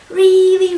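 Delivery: really said loud and high; stress on really. A narrator's reading. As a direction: punch the word really.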